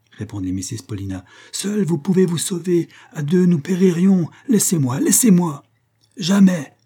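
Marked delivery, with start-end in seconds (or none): 1.5-5.6 high, urgent plea growing louder; desperate pleading. A narrator's reading. Woman begs the lieutenant to save himself, desperate plea. 6.2-6.7 high, loud cry of refusal; exclaimed refusal. A narrator's reading. Lieutenant refuses to abandon her, loud emphatic cry. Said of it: "s'écria le lieutenant Hobson".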